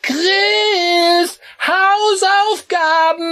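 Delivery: very high, loud, drawn-out call; mother calling after him. Acted. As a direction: shout him back from the door. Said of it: "seine Mutter rufen".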